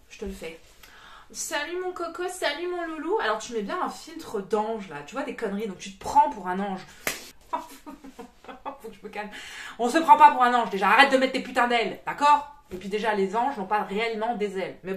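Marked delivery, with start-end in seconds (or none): 1.3-3.2 high-pitched parody healer greeting; mock-cooing energy healer; mock sweet healer. Mimics online energy-healer's greeting. 9.8-12.5 fed-up outburst, much louder; exasperated scolding. Real, not acted. Scolds fake angels after calming herself.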